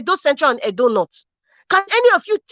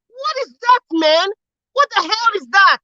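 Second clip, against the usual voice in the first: raised, exasperated voice at the governor; angry outburst; 6 semitones higher, slower than usual. Real, not acted. Vlogger venting at the governor, angry and raised. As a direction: let the anger rise on each question. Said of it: "'cause I'm really angry".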